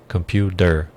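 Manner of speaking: "computer" broken up, ending in a clear "der"; D in place of T. Shown as a demonstration. Teacher spelling out the D sound for learners, D in place of T. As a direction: slowly say "computer" as "compyoo-der". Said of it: "como se um D estivesse ali".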